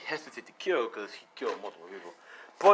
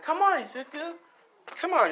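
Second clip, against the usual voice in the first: much higher, sing-song urging; playful coaxing; 4 dB louder than usual. Acted. Mina coaxing Izuku to dance, high and playful. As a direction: tug him onto the floor.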